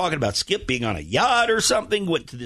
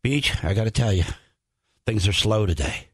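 downbeat retold complaint; 8 semitones lower than usual. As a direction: imitate a bored producer with nothing to offer. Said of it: "one of the first things he said to me was this".

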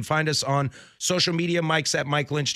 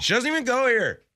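quoting a movie line; 12 semitones higher, 3 dB louder than usual. Retold or imitated. Movie quote joke.

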